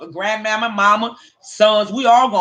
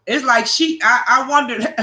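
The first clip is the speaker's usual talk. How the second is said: ordinary talk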